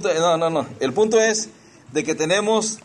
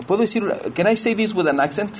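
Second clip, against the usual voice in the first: put-on higher-pitched child asking permission; a hint of a boy, pitched up; imitated boy; 6 semitones higher than usual. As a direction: lightly voice a boy's eager question. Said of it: "Y él".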